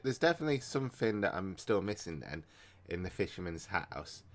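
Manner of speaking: usual speaking voice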